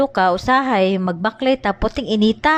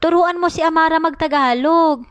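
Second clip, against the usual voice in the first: mimicked teacher's instruction, pitched up; imitated teacher; 6 semitones higher than usual. Teacher telling a mother to teach her child Tagalog, mimicked in a higher voice. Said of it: "Mingon ang maestra".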